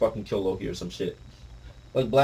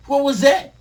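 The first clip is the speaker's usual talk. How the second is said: angry retort; 14 semitones higher, 9 dB louder than usual. Acted. Loki reacts angrily.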